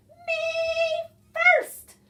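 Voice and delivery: high, loud shriek. A narrator's reading. Pig shrieks to be served first, high shriek. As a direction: shriek it out, piercing. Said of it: "Pinkerton shrieked".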